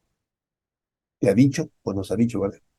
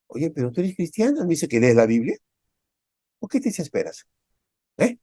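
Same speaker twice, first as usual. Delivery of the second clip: scoffing husband; 4 semitones higher than usual. Retold or imitated. Unbelieving husband mocking wife.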